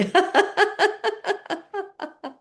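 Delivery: high, rapid staccato fake laugh; fake laughter. Shown as a demonstration. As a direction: force out a high run of ha-ha laughter. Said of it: "slightly fake laughing sounds".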